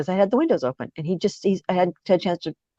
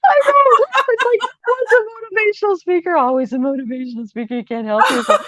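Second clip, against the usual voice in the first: laughing at being a motivational speaker even then. Real, not acted. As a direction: crack up at your own habit. Laughing at herself.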